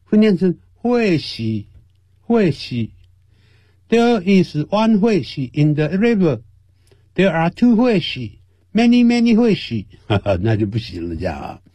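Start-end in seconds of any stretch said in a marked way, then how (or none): 0.7-2.9 put-on "惠西" with a Hokkien accent, as hui-si instead of fish; a higher, mocking Hokkien "惠西". Teacher mocking how Hokkien speakers say "fish". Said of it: "他们都会念成什么东西呢". 3.9-9.9 higher, mocking Hokkien "惠西" for fish throughout; Hokkien-accented English. Teacher joking how Hokkien speakers count "fish". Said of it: "他们都会念成什么东西呢".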